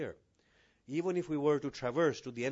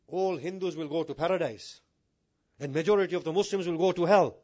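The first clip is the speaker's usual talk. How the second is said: loud, raised mock pronouncement; hammed-up pulpit declaration; mock proclamation; 4 semitones higher, 8 dB louder than usual. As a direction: proclaim it provocatively, not in earnest. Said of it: "if I had said that with a serious tone".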